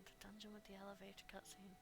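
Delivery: soft whisper to herself. Real, not acted. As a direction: whisper it under breath. Whispered repeat to self.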